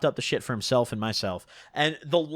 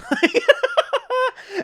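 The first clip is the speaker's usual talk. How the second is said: long, high laugh; high wheezing laugh. Real, not acted. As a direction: crack up at your own story. Laughing at his own story.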